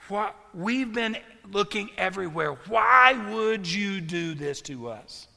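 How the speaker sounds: slow, loud, pleading reproach from a worried mother; upset mother. Retold or imitated. Mary scolding her lost son, slow loud anguished reproach. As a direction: let relief and anger spill out slowly and loudly. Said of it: "And she goes".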